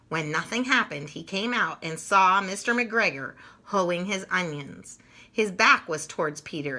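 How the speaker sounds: lively read-aloud for little ones; storybook narration. A narrator's reading. Reading Peter Rabbit to children, animated storytelling. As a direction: tell it like a bedtime picture book.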